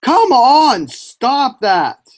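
loud frustrated yell. Real, not acted. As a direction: shout it at the screen. Yelling at rival racer.

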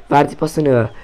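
normal talking voice

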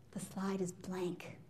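slow hushed whisper; a conspiratorial hush to the audience; whispered aside. Acted. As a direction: whisper it like a secret punchline. Deadpan aside about the empty slide.

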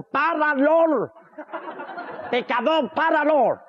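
hammed-up saintly halt; mock command to stop. Saint ordering motorbike to stop. (orden teatral de alto)

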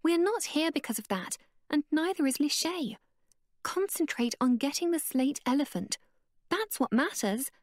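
high, firm stop; sharp cutoff. Acted. Abeka silencing Connor, high and firm. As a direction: cut him off short.

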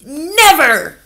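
high, loud outburst; horrified cry. Acted. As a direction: cry out in horror. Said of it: "cried Mister Stringer".

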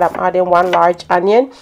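usual speaking voice

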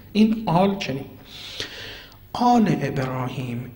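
normal talking voice

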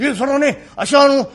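ordinary talk, nothing marked

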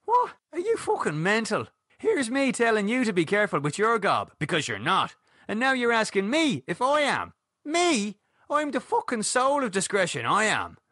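high-pitched, affronted rant; incredulous and offended, voice pitched right up; indignant outburst. A narrator's reading. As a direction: protest your innocence in high-pitched outrage. Acted quarrel, accused of loose talk.

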